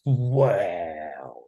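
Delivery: long, low drawn-out wow. Retold or imitated. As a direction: let it sink slowly in hushed wonder. Retelling awe looking at stage.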